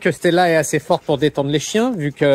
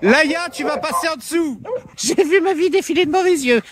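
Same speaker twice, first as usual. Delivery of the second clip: mock alarm; 10 semitones higher than usual. Mock alarm as dog passes underneath.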